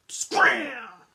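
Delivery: loud barked command; one sharp shout to drive him away. Acted. Big dog shouts at the stray to leave, loud. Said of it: "the big dog shouted".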